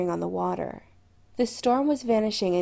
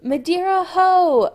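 high, loud sailor's shout; call from deck; 10 semitones higher, 9 dB louder than usual. A narrator's reading. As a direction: bellow the landfall across the ship. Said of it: "a call came from outside on deck".

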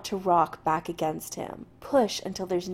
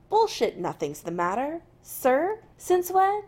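rising indignant disbelief, louder and higher; 8 semitones higher, 5 dB louder than usual. Acted. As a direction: push back, outraged and incredulous. Jim challenging McCoy's coldness.

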